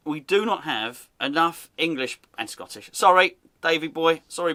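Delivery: ordinary talk, nothing marked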